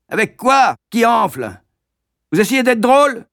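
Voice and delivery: high, loud mocking master; sneering schoolmaster; hectoring. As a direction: needle the boy.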